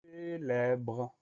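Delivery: long, level, stretched syllables; drawn-out dictation. Shown as a demonstration. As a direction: hold each syllable as if dictating. Dictée, mot annoncé lentement. (mot de dictée prononcé en étirant les syllabes)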